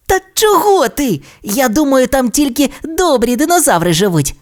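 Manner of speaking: put-on high-pitched coaxing kid; carefree little dinosaur. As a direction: brush off the worry in a high voice. Timmy reassures his timid friend.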